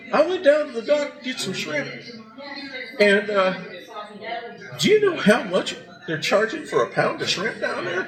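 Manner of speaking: a hint of an outraged older woman; indignant mother-in-law. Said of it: "And she goes".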